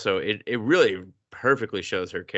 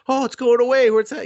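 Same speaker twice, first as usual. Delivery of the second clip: much higher and louder mock dismay; mock alarm; 14 semitones higher, 7 dB louder, faster than usual. Heroine noticing it shrink, high and loud mock dismay. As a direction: squeal it with fake alarm.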